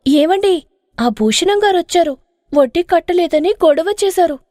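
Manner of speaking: put-on worried young wife. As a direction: report the trouble nervously.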